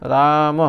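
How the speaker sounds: "राम" lengthened into "raa-mah"; visarga as h. Shown as a demonstration. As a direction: close "राम" with an audible h sound. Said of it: "विसर्ग का उच्चारण ह के समान होता है".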